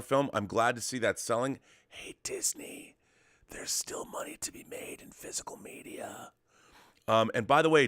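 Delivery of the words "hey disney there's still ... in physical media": stage whisper. Pretend. Aside pleading to Disney.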